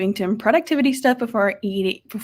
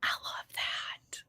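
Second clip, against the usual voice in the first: whispered approval; 15 dB quieter, much slower than usual. Real, not acted. Reacting to a viewer's book.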